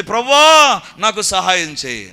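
slow, loud cry for help. Acted. Father crying to Jesus for help, from within. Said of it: "తన లోపల నుంచి కేక వస్తుంది".

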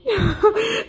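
high laughing sob; tearful laugh. Real, not acted. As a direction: let out a high, crying laugh. Overcome, on the verge of tears.

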